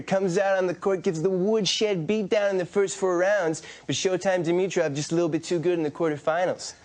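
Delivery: brash American tennis pundit hyping players; Brad Gilbert impression. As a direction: pile on the nicknames like a pumped-up commentator. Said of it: "do you have a Brad Gilbert".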